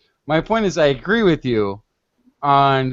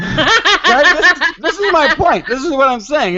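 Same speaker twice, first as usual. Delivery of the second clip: laughing; 11 semitones higher, 5 dB louder than usual. Real, not acted. Laughing while pressing her point.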